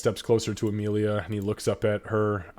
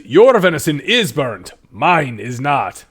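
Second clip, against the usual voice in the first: loud, higher dwarven retort; boasting dwarf; 4 semitones higher, 10 dB louder, slower than usual. Acted. Dwarf answering about burnt venison, loud boast. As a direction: crow it loudly, slowing on the last words.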